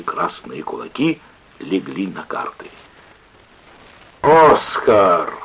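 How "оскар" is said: loud roaring call of a name; barked. A narrator's reading. Drunken sailor to old friend, barking. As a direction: bellow the name at your friend. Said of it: "рявкнул матрос".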